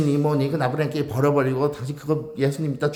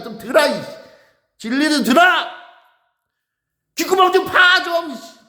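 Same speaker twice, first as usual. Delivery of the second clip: harsh scolding; 11 semitones higher than usual. Acted. Preacher scolding the congregation, furious high shouting. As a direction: bellow it like a prophet who has run out of patience. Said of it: "강하게 얘기한다".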